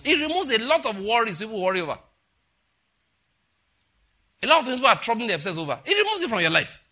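high, loud preaching surge; impassioned preaching. Real, not acted. Preacher telling congregation what the life of Christ does, impassioned. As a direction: preach it high and forceful, voice rising to a peak.

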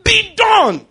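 loud, slow declaration in Jesus' name; preaching at full force. Acted. Pastor declaring victory over a marriage, loud preaching. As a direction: proclaim it over the house like a decree.

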